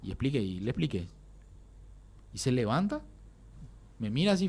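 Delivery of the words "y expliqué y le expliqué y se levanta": usual speaking voice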